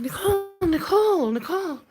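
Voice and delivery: high, sing-song calling out Nicole; calling her name. Retold or imitated. Imagined reporter calling after a worried mother, high and eager. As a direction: call Nicole like a reporter trying to get her attention. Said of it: "They're like".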